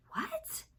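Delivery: high shrieked disbelief; shocked what. Retold or imitated. Hearing the upstairs flat is flooding, high shocked squeak.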